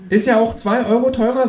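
high cheeky kangaroo. Acted. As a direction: answer like the cocky kangaroo.

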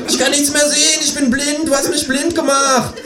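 high, hammed-up kangaroo lament; Känguru voice. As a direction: wail it high like a cartoon animal. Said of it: "schreit das Känguru".

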